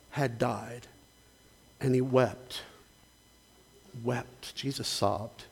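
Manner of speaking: hushed solemn sermon. Acted. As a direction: drop almost to a murmur to let the grief land. Preacher dwells on Jesus' grief.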